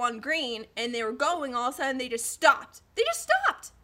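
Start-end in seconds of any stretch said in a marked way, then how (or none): 3.0-3.7 very high-pitched incredulous repeat; exasperated squeak. Retold or imitated.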